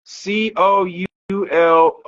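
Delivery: ordinary delivery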